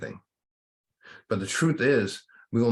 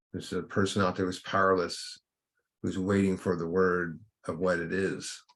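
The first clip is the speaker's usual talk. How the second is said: guided meditation. Acted. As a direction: guide them gently inward, taking your time.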